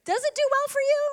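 high, far-off pleading echo of God; God's voice, echoing. Retold or imitated. Preacher voicing God asking the church, high and pleading. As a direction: let God's question float up high. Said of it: "he has asked us".

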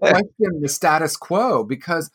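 talking through real laughter, pitch up; laughing while talking. Real, not acted. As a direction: chuckle your way through the admission. Laughs at own rebelliousness.

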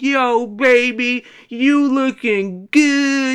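high, loud, slow drawn-out pickup line; catcalling Puerto Rican guy. Retold or imitated. Man in stairwell hitting on a lady, slow loud catcall. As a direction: lay on the smooth catcall. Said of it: "and I said".

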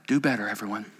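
hammed-up pious lament; mock sigh. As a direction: sigh down at everyone. Mock Pharisee sighs.

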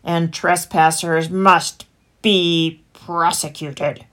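slow, loud growl; heavy, drawn-out animal growl; growling fox. A narrator's reading. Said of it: "growled the fox".

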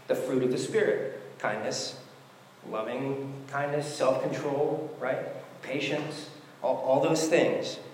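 ordinary talk

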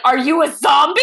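mock shout. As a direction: blurt it out like you've spotted a zombie. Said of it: "he's like".

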